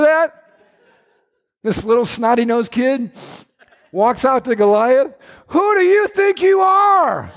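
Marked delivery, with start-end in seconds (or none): 5.5-7.3 defiant shout. Acted. David shouting down Goliath.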